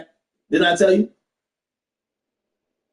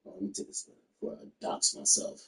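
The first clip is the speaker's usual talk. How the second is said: whispered aside; 16 dB quieter than usual. Real, not acted. Hiding personal info on stream.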